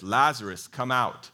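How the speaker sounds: a strong call into the tomb, loud and falling. A narrator's reading. Jesus calling loudly to dead Lazarus at his tomb, strong loud call. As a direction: call him out of the grave with full voice. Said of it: "Jesus called out in a loud voice".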